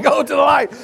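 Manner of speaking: high, loud shouted repeat of the line; mock shouted command. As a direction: bellow it like a revival call. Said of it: "You know what I'm saying?".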